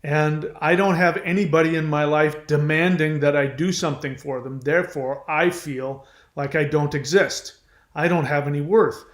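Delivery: ordinary delivery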